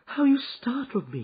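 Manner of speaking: high startled exclaim. Acted. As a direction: gasp it out, still shaken. Wife startled by friend.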